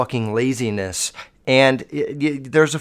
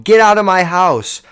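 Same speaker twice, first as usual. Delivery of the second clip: imitating a homeowner's shout, louder and higher; retold yell; 5 semitones higher, 6 dB louder than usual. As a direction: shout the burglars out, retelling it. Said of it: "and they yelled".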